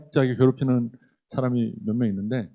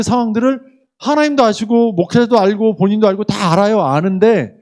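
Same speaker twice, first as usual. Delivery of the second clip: higher and louder, building into a fervent sermon climax; impassioned preaching; 6 semitones higher, 4 dB louder than usual. Acted. Pastor pleading with couples from the pulpit, fervent and loud. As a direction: pour it out to the congregation with rising fervor.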